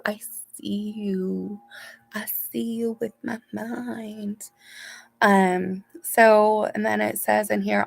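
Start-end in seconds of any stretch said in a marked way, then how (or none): 0.0-4.5 slow, soft, mystical I see you. Pretend. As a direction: draw it out like a seer.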